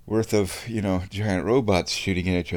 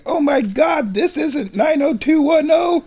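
much higher, shrill with disbelief; shocked realization. Real, not acted. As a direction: squeal in horrified surprise. Shocked the wrong show is on.

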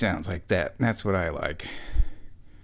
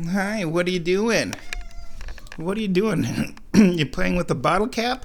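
pet talk; 8 semitones higher than usual. Real, not acted. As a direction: coo at the kitty. Talking to the cat.

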